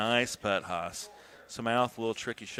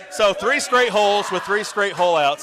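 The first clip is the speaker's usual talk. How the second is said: loud, higher excited call; excited shout; 7 semitones higher, 10 dB louder than usual. Real, not acted. Commentator hyping three hole-outs, shouting.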